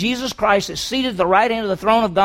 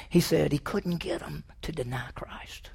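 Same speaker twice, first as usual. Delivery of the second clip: hushed whisper of awe; 3 semitones lower, 10 dB quieter than usual. Real, not acted. Preacher tells the church in a whisper. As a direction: whisper it to the room. Sermon aside.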